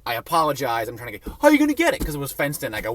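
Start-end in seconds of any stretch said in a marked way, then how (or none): 1.4-2.0 indignant challenge. Retold or imitated. Son challenging.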